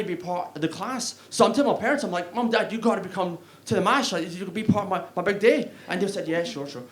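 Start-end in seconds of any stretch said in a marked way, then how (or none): none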